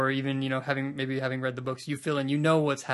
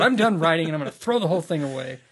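mock author; 3 semitones higher, 6 dB louder, faster than usual. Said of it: "You just decided".

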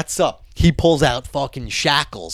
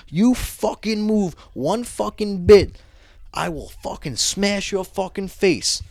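slow, higher-pitched tough-guy threat; retold menacing threat; 7 semitones higher, slower than usual. Guard warning a handcuffed man, slow acted menace. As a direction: spell the threat out slowly, imitating an angry guard.

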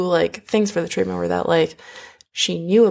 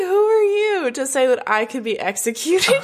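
higher, affronted challenge; indignant defiance; 9 semitones higher than usual. Retold or imitated. Vaudémont defying the unknown king, higher indignant voice. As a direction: puff up and challenge him.

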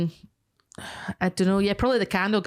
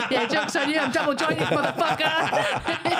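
higher, giggling smug taunt; laughing taunt; 8 semitones higher, slower than usual. Pretend. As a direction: gloat while laughing. Playing the smug contortionist victim.

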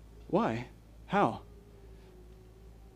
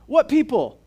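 pressing question; 8 semitones higher, 7 dB louder than usual. Real, not acted.

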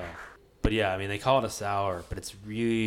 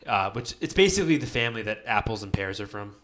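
ordinary talk, nothing marked

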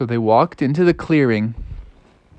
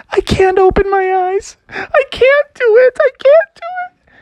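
very high, wailing squeak, getting higher; terrified Froggy; 4 dB louder than usual. Acted. Froggy won't open eyes to see wolf, terrified. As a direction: squeal helplessly in mock terror. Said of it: "And Froggy said".

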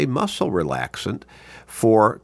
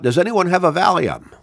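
raised, higher-pitched mock call for help; retold yell; 9 semitones higher, 4 dB louder than usual. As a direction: call out to a crowd, half yelling. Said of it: "he sort of yelled out".

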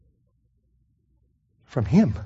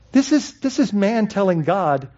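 ordinary delivery

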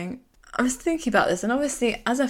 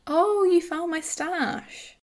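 playful exclamation; 9 semitones higher, slower than usual. Retold or imitated. Quoting a character caught with champagne.

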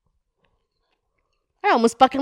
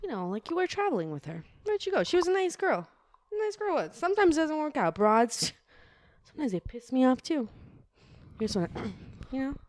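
friendly cop, much softer and chummy; soft good cop; 3 semitones lower, 10 dB quieter than usual. Acted. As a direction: win him over gently like a buddy.

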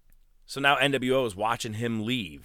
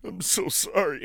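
higher, put-on Hogan voice, fake sincere; mock Hogan's hollow apology; imitating Hogan; 7 semitones higher than usual. Hogan faking an apology to Giant, higher put-on voice. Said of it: "This is exactly how he sounded".

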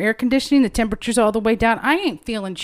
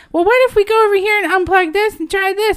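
imitating Aunt Sue; 10 semitones higher, 4 dB louder than usual. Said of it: "here's Aunt Sue on this side".

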